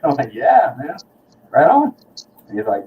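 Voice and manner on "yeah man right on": proud and pleased. Retold or imitated. Proud reply to the musician.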